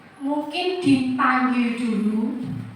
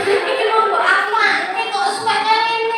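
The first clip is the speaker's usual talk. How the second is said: blaming; 7 semitones higher than usual. Shown as a demonstration. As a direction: scold the child with a guilt-tripping tune. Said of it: "Membuat merasa bersalah".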